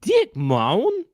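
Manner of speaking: deliberate mimicry with a rising end; imitating ad woman. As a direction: mimic her puzzled, word-by-word delivery. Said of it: "She goes like this".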